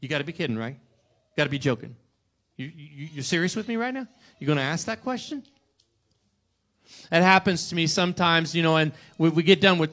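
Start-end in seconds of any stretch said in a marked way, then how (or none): 0.0-5.4 low, soft, sarcastic disbelief; mock incredulous scoff; snarky. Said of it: "snarky and go".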